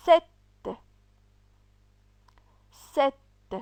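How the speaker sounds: each syllable said clear and firm, twice over; crisp syllable-by-syllable drill. Shown as a demonstration. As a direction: drill every syllable for the class. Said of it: "on a la première syllabe".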